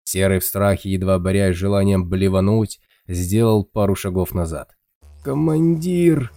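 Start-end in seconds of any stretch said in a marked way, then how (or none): 5.2-6.3 high, slow and feeble undead rasp; weak creaky voice. Acted. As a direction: croak it out like a dying ghoul. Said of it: "слабым голосом проскрипело".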